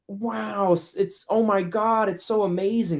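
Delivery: high-pitched gushing American parody; hammed-up, high-pitched awe; mocked American excitement. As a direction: gush like an over-amazed American, mockingly. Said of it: "when French people mock Americans".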